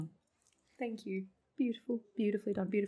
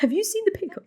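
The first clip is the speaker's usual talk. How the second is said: higher, lightly imitating the character's prim question; mock character echo; 10 semitones higher, 3 dB louder, faster than usual. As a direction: parrot the lady's line, pitched up. Streamer echoes the character's line.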